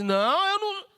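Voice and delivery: loud, high-pitched protest of innocence; lying denial. Acted. Sara denying to the angel that she laughed, high loud denial. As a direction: blurt an indignant, untrue denial. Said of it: "Aí ela chegou e mentiu".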